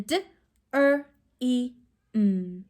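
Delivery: "D, er, e, m" as separate sounds; sounded out. Shown as a demonstration. Teacher repeats four sounds in dream, segmented. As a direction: say each sound of "dream" on its own. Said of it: "Four sounds in".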